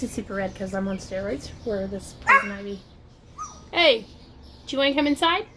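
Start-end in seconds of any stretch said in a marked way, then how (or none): 3.7-5.5 raised, louder question to a pet; calling the dog. Real, not acted. Owner asking the dogs to come inside, calling out. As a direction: call it out higher, as to a dog.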